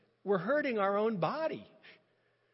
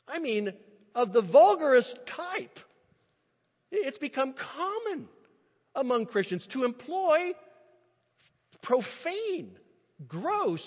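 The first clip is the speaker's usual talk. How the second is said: higher and louder, rising indignation; impassioned preacher's protest; emotional; 7 semitones higher, 7 dB louder than usual. Real, not acted. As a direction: let the outrage climb with each word. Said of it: "I'm sorry if I'm inappropriately emotional".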